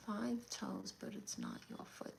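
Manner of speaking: very quiet, half-whispered repeat; murmuring to oneself. Real, not acted. Streamer puzzling over a viewer's riddle, murmured to herself. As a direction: think it over under your breath.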